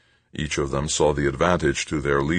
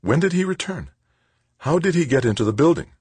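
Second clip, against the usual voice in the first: much higher, fast startled questions; alarmed questions; 12 semitones higher, 3 dB louder than usual. Acted. Angelique startled, questioning the chief of security. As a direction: fire the questions out in shock.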